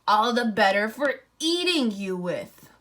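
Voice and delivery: loud, triumphant wolf threat; menacing wolf. Acted. Wolf revealing himself to the girl, loud and menacing. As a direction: pounce with the words.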